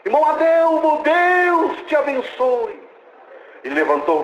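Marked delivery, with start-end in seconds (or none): 0.1-2.8 high, slow, otherworldly call; a distant divine voice calling his name; heavenly voice. Retold or imitated. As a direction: speak as a voice from heaven, drawn out and high. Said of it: "Só que era diferente".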